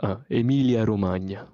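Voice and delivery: native Italian pronunciation of the region name; Italian accent. Shown as a demonstration. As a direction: say it like an Italian local. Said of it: "but in your accent".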